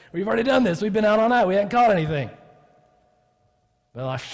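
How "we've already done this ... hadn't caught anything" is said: loud, higher-pitched mock indignation; exasperated protest. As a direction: push back at the unwanted advice, loudly. Fishermen protest the stranger's advice.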